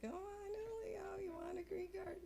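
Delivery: soft, very high teasing singsong; high coaxing voice. Acted. Woman tempting Julio with a green card, high soft coaxing. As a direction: dangle the offer in a tiny high voice. Coaxes Julio again in tiny voice.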